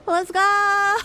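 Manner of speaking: high, loud rallying cry; excited shout. Acted. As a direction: yell it to charge ahead.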